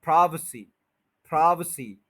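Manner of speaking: प्राइवेसी as "PRAAI-v'-see"; reduced middle vowel. Shown as a demonstration. Teacher models the correct word, schwa middle. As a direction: stress the start of प्राइवेसी, shrink "vay" to "vuh". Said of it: "कम कर दें".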